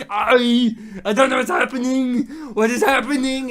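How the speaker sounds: high, loud, bewildered Austrian bellow; Schwarzenegger. Acted. Bewildered action star standing there, hammed-up shout. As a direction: shout it as a confused Austrian muscleman. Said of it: "the idea that Schwarzenegger's standing there".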